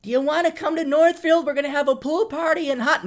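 high, lilting invitation; mock invitation. Imitating friends inviting him, high sweet voice. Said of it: "family and friends".

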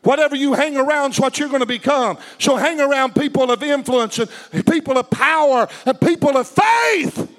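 rising preacher's shout; preaching. Acted. Preacher rallying the congregation, building to a shout.

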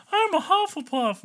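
high, loud mock superfan squeal; gushing fan. Mocking the kind of Harry Potter fan they aren't, high gushing voice. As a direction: mimic an over-keen fan, high and loud. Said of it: "am I the person like".

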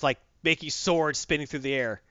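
usual speaking voice

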